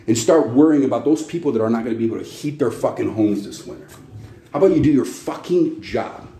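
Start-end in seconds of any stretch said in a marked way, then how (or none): none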